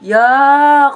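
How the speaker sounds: loud, high "yuck"; drawn-out cry of disgust; disgusted yell. Acted. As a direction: recoil from a flirty friend. Friend recoils from flirting.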